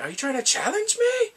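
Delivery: very high, loud affronted cry; mock indignant challenge. Imitating the man confronting someone, high loud indignant challenge. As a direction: throw it out shrieking and offended.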